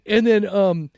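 normal talking voice

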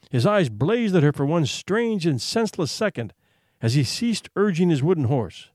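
ordinary delivery

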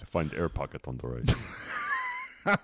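mock Russian accent. Rasputin voiced, broken English.

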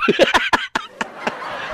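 shrill burst of laughter; cracking up high; squealing high laugh. Real, not acted. Cracks up at own story.

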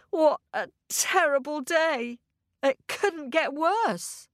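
ordinary delivery

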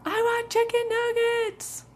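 put-on very high, drawn-out child whine-yell; mock tantrum cry, slow and high; tantruming four-year-old. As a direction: imitate a kid erupting mid-tantrum. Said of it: "he calmed down a little bit".

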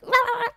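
high yelp in a tiny dog voice; little dog yelling. Retold or imitated. Imitating the dog yelling while she was on stage, high yelp. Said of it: "yelling at the top of her little dog lungs".

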